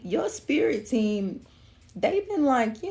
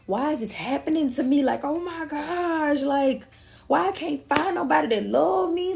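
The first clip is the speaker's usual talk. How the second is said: mock whining; 6 semitones higher than usual. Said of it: "you like".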